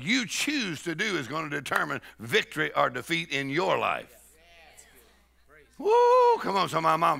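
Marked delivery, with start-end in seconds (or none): 5.8-6.4 high-pitched praise "woo"; high whoop. Real, not acted. Celebrating in a sermon.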